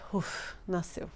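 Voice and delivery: soft, slow breathy sigh of relief; relieved sigh. Retold or imitated. Mother telling how the baby finally came, breathed out in relief.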